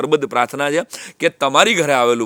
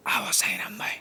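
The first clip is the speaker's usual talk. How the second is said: hushed whisper of a greeting at the door; whispered welcome; 7 semitones higher, 14 dB quieter than usual. Retold or imitated. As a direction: whisper the welcome so the boy studying isn't disturbed. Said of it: "આટલું ધીમેથી અમને અંદર બોલાવ્યા". (ધીમા અવાજે ફફડાટ)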